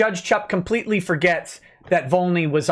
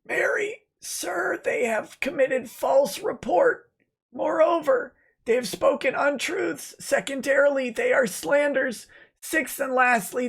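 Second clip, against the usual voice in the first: high mocking impression of a lawyer reciting charges; mocking Lemoyne voice; 8 semitones higher than usual. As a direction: ham up a pompous lawyer. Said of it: "I will be doing Lemoyne's voice".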